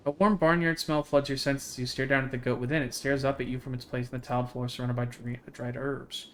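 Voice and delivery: even, measured read-aloud of the story text; game text narration. Acted. Streamer reading game text to chat, steady narration. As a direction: read the scene out steadily to viewers.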